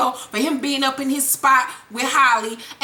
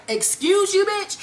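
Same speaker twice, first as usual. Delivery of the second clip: mock-offended, higher-pitched comeback; raised-pitch mock indignation; indignant retort; 7 semitones higher than usual. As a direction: act out his affronted disbelief, pitched up. Retold offended reply to a confession.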